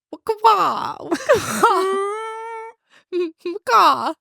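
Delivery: high-pitched vocal sound effect; goofy high "waka-wa" imitation; imitated song sound. Host mimicking the sound from a friend's song pick, goofy imitation. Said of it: "couldn't remember what exactly it sounded like".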